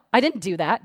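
ordinary delivery

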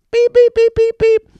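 high, loud robotic beeping; machine beeps. Retold or imitated. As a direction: imitate the scanner beeping. Imitating self-checkout machine beeping.

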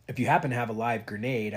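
usual speaking voice

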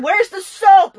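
imitated high, loud demand; aggressive demand. Sister groping for soap in the shower, aggressive demand. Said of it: "reaching her hands out at me aggressively".

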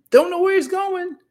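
high, loud disbelief; incredulous echo. Acted. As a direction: repeat it as if it's absurd. Incredulous echo about Abraham's leaving.

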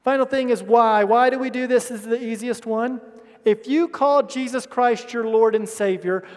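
ordinary talk, nothing marked

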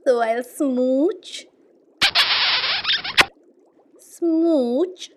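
slow, rising smooches ending very loud; drawn-out smooch. Acted. Drawn-out kisses at the end.

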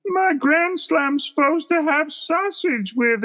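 very high, slow, spacey cartoon voice; [name] cartoon voice. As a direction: squeak it out like a goofy cartoon Ace. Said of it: "that cartoon voice that they always give him".

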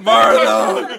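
loud laughing call. Real, not acted. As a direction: shout the name laughing. Calling out Martha loudly.

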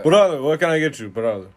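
booming, high-pitched shop boss; boss impression. Imitating the boss taking an order, loud high impression. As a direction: play the boss greeting a customer loudly. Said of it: "pretty good impression of the boss".

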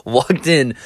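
laughing while telling it; words broken by real laughter; real giggling through the story. Real, not acted. Laughing through the funny part.

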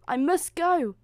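high, loud urgent exclamation. A narrator's reading. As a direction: break away in alarm. Cinderella must leave at midnight.

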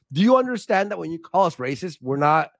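ordinary delivery

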